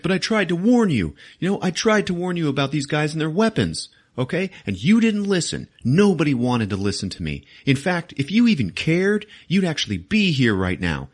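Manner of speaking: indignant protest. Retold or imitated. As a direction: argue back heatedly as an aggrieved kid. Peter angrily argues back at Stark.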